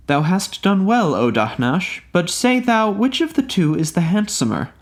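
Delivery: lightly voiced Maimuna demanding an answer. A narrator's reading.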